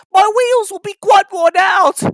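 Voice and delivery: high, loud wail; worn-out little engine. Acted. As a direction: cry out the complaint. Exhausted little engine complaining.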